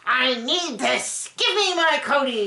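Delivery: slow, drawn-out mock plea from Robin. Mocking the wife as she begs for her husband. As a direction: whine it out like a spoiled wife. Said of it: "While Robin is over there going".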